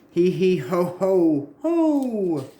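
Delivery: slow high taunting cackle; mocking villain laugh. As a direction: taunt with a drawn-out cartoon cackle.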